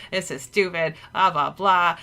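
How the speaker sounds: ordinary delivery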